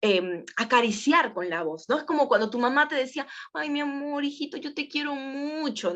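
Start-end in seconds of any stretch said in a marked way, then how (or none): 3.5-5.9 higher and softer, a tender mother; gentle loving mom, lightly voiced; caressing mother's voice. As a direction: stroke the words like a mother soothing her child. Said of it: "Es como acaricias con la voz".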